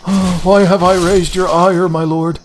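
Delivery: high, anguished lament; hammed-up old monk; despairing monk. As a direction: plead to heaven in mock despair. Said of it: "I was like".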